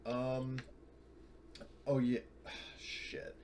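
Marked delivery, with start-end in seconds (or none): none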